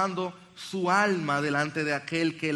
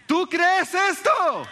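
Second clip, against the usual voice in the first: much higher and louder, slow on "esto"; a slow pulpit shout of "¿Tú crees esto?"; impassioned shout; 13 semitones higher, 7 dB louder, much slower than usual. Acted. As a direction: cry the question out to the whole room. Preacher repeats the challenge louder.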